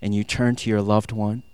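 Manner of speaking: low, soft storytelling voice; hushed, dreamy narration; romantic scene-setting. Acted. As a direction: paint the scene quietly, in short unhurried phrases.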